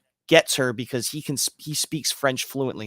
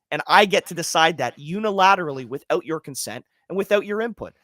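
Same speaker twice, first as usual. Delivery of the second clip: ordinary delivery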